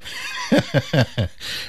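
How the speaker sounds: soft laugh. Real, not acted. Laughing after dad joke.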